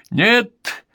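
very high, sharp cry; shouted refusal. A narrator's reading. Husband refuses his wife's plea, high shout. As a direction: cry out in high-pitched alarm. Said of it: "крикнул её муж".

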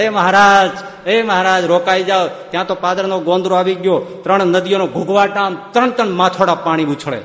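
loud, high urgent call; shouting after him. Acted. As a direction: yell over the roaring river to stop him. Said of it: "માત્ર ધાધલ બૂમ પાડે".

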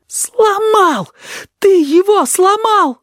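very high, anguished wail; drawn-out, high-pitched sobbing cry; sobbing outcry. A narrator's reading. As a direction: wail the accusation in distress. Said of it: "всхлипнул кузнечик".

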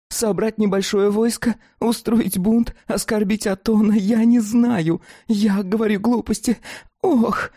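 high, fretful creature voice; anxious dog-woman voice. Acted.

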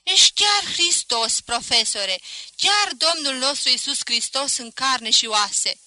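high, loud, slow proclamation; shouted mock praise. A narrator's reading. Shopkeeper calling to the professor from his doorway, shouted proclamation. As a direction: call it out grandly, drawing out the words. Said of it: "strigă din ușa prăvăliei".

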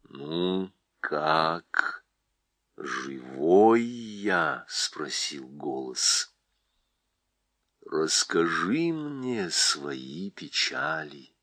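low, slow and gentle; a wise old voice, unhurried; deep calm voice. Acted. Голос Льва, низко и медленно. (низкий ласковый голос)

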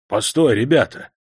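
usual speaking voice

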